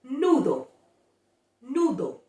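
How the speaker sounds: nudo with pure u; model Spanish. Shown as a demonstration. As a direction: nudo deutlich, reines u. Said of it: "For example".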